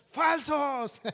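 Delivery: joking yell of "falsos" at a fake preacher; retold shout. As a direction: shout it at the whole church as a joke. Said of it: "les vamos a gritar".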